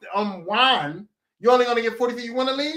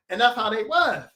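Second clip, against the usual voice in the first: normal talking voice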